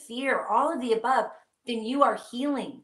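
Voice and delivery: normal talking voice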